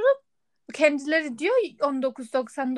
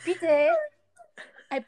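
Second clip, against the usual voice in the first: ordinary delivery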